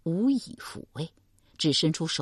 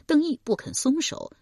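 usual speaking voice

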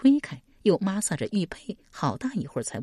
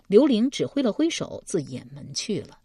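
usual speaking voice

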